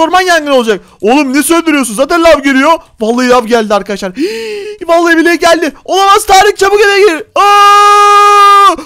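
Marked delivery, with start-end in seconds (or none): 4.2-4.8 high, soft intake of breath; frightened gasp. Acted. Player seeing the lava arrive, frightened gasp. 4.9-8.8 high, loud alarm ending in a scream; panicked shouting. Acted. Player urging his friend indoors from lava, panicked shouting.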